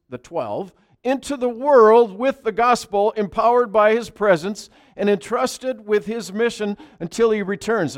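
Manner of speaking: loud, high, driving sermon voice; preaching at full force. Acted. Pastor proclaiming to the congregation, at full force. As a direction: preach it out to the whole room.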